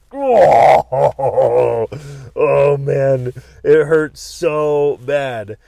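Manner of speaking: loud pained cry with a laugh. Retold or imitated. Doctor taps hard, loud pained cry. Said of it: "And I was like".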